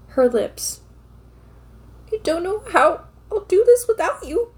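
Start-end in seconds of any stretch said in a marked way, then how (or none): 2.1-4.5 high, tearful, breaking voice; Lorraine crying voice. Acted. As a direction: sob the line out as a grieving wife. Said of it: "I enjoy doing a Lorraine crying voice".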